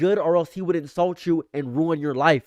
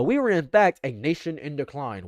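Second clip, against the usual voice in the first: usual speaking voice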